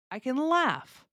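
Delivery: "laugh" long and falling, "can" light; stress on LAUGH. Shown as a demonstration. Teacher gives another example of ability, stress on "laugh". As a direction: land on the verb "laugh". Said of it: "put the stress on the verb".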